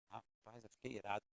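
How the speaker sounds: usual speaking voice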